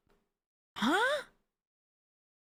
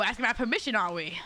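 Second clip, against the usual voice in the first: normal talking voice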